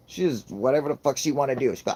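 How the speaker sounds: ordinary talk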